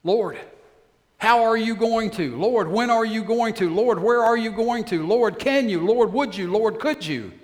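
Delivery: loud, high, urgent questions to the Lord; pleading prayer. Acted. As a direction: beg God again and again, pressing hard. Moses pleading with God.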